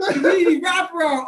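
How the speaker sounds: loud, high shouted catchphrase; imitating Pastor Sandoval. Speaker mimics his pastor shouting his slogan, loud and high. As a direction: belt it out like the pastor preaching. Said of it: "he's like".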